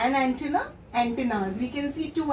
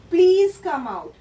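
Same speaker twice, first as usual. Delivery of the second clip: a loud, pleading please, louder; pleading request; 3 semitones higher, 7 dB louder than usual. Acted. Anthea begs the creature to come out of the hole, loud pleading. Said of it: "she pleaded or she requested".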